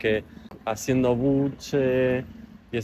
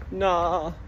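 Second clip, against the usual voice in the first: mock horrified no; 8 semitones higher than usual. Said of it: "Y yo tipo".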